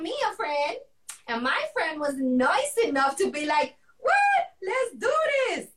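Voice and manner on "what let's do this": high-pitched, thrilled exclamation; excited yes. Retold or imitated. Friend agreeing to join, excited high-pitched yes. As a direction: act out a friend jumping at the offer.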